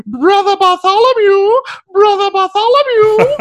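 very high, loud squeaky character voice. Retold or imitated. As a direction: squeak the name up high like Mickey Mouse. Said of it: "Stephen Fry doing a really funny voice".

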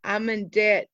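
very low, soft confession; grave confession. Acted. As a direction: drop to a heavy hush.

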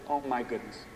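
ordinary talk, nothing marked

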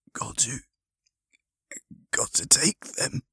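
broken, effortful whisper with long pauses; halting whisper. Acted. Injured man pleading with his rescuer, halting whisper. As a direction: whisper it as your strength fails. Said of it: "whispered the man".

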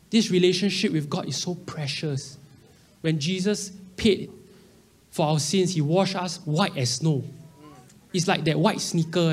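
ordinary talk, nothing marked

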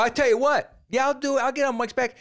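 high, hammed-up cocky voice; cocky acceptance. Answering Rogan's challenge on air, cocky and high. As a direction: accept the dare like a showman.

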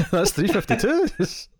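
high, slow, teasing goofy repeat; mock repeat. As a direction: stretch it out high and silly, poking fun. Mocking a repeated phrase.

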